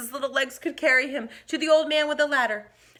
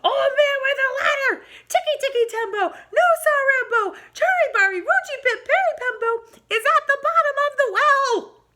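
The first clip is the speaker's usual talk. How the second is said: very high, loud, last-breath yell; desperate shout; 9 dB louder than usual. Acted. Chang shouting his final plea to the old man, very high and loud. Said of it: "he shouted".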